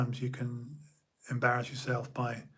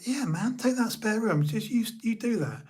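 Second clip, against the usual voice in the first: light, higher casual voice of laid-back friends; breezy higher "yeah man, sure"; easygoing friends; 8 semitones higher than usual. Retold or imitated. Friends welcoming him to stay, voiced higher and breezy. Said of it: "they'd be like".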